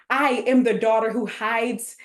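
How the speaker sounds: usual speaking voice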